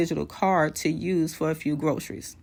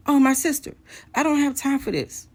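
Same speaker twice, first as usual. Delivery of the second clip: high, louder exasperation; retold angry dismissal, pitched way up; imitating irritated brush-off; 9 semitones higher, 4 dB louder than usual. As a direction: act out an impatient husband cutting her off. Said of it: "He does the same thing again".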